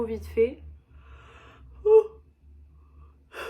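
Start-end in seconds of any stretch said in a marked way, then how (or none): 1.8-3.4 loud, high "ooh" burst; high whoop. Real, not acted. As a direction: let out a sudden high hoot. Letting out energy before calming.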